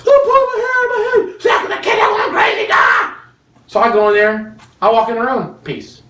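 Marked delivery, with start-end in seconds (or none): 0.0-3.1 overwhelmed mom at wits' end; husband mimicking his wife with the kids; mock frazzled wife. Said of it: "She's coming to me saying".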